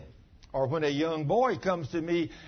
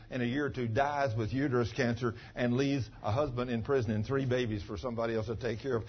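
ordinary talk

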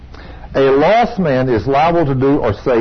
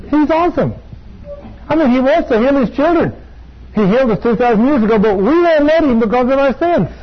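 high, impassioned praise, pitched way up; fervent preaching; 11 semitones higher than usual. Acted. Preacher praising God to the congregation, high and fervent. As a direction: preach it full of fire.

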